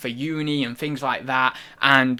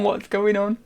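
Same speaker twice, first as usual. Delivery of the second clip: usual speaking voice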